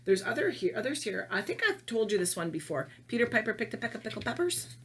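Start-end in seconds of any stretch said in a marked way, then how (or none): 3.1-4.8 tongue twister rattled off fast; quick. Shown as a demonstration. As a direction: run the words together at speed. Quick first take before slow one.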